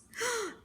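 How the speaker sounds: high, sharp gasp; delighted gasp. Acted. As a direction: gasp in surprise. Gasp before urchin answers.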